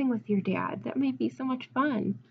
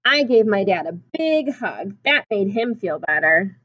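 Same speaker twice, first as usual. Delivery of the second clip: storybook reading; 5 dB louder, slower than usual. A narrator's reading. Reads picture book aloud.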